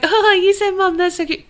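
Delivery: high-pitched mock cooing; gushing adoration. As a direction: coo it high, like something adorable. Gushing over co-host's pronunciation.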